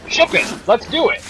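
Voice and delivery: high, loud gung-ho approval; eager executive. Acted. Executive sold on an elevator pitch, loud mock enthusiasm. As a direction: play a mock executive excitedly green-lighting a film. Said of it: "and they're like, ... an executive just saw".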